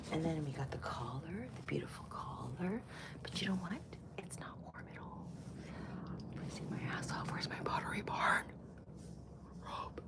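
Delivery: whispered complaint. Real, not acted. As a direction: whisper it like a confession. Whispering complaint about robe.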